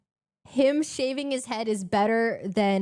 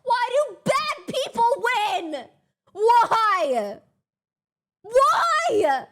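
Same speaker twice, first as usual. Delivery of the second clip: high, loud mock outrage; anguished yell; 13 semitones higher, 9 dB louder, much slower than usual. Real, not acted. Streamer wailing as the rival wins. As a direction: wail at the unfairness, slowly.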